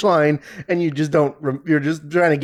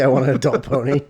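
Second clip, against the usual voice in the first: laughing. Real, not acted. Repeats partner's line laughing.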